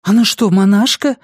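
high, loud alarmed cry; frightened exclamation. Acted. Mother startled to hear her son's girl is a nun, frightened exclamation. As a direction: blurt it out in alarm. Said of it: "испуганно воскликнула она".

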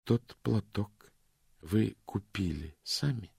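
slow, each word set apart; "Тот платок" lightly voiced, then slowing; slow and deliberate. A narrator's reading. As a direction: weigh every word of the question. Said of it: "Очень медленно и раздельно она произнесла".

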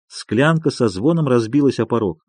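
ordinary delivery

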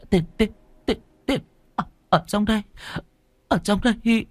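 trembling, stumbling over the words; panicked stammer. Acted. Panicked wife opening money chest. (lắp bắp hoảng loạn, giọng cao)

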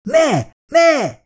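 high, frantic repeated cry; desperate shout. A narrator's reading. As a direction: scream no at a man who can't hear. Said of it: "kiáltott Daniels újra meg újra".

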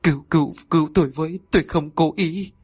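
high, stuttering terrified plea; wife stammering before the exorcist, terrified; stammering in fear. Acted. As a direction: babble for mercy. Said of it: "cô ú ớ".

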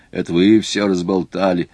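usual speaking voice

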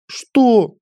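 high, outraged exclamation; indignant. A narrator's reading. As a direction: burst out in disbelief at the price. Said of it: "возмутилась девушка". (возмущённо)

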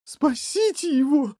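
high-pitched cry for help; panicked shriek. A narrator's reading. Mother seeing her son fall in, shrieking. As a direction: squeal for rescue. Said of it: "заверещала бедная мать".

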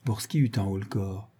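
usual speaking voice

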